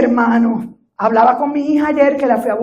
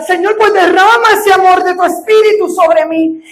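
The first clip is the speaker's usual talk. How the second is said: pleading cry to God; 8 semitones higher, 7 dB louder than usual. Retold or imitated. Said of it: "Yo empecé a llorar".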